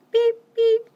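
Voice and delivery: high, loud toy horn beep; squeaky horn beep. Acted. Teacher reading the refrain, loud squeaky horn beep.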